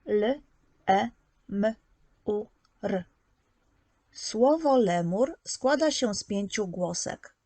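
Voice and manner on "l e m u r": each sound said separately with pauses, L, E, M, U, R; sounding out phonemes. Shown as a demonstration. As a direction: spell out the word sound by sound for a child. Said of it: "podziel na głoski słowo lemur". (głoskowanie, każda głoska osobno)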